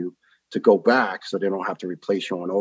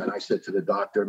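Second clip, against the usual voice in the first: normal talking voice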